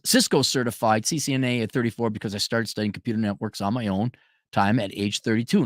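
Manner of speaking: usual speaking voice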